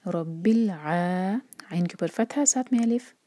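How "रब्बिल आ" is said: रब्बिल आ slow, ع not a glottal stop; recitation demo. Shown as a demonstration. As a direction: recite रब्बिल आ with a true ain. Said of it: "आइन को हमज़ा नहीं पढ़ना है". (रब्बिल आ, ऐन के साथ)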